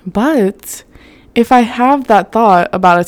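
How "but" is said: normal talking voice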